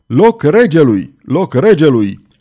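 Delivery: loud, slow proclamation with irony; ironic shout. A narrator's reading. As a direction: clear the way, half mocking. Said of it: "strigând". (strigăt ironic)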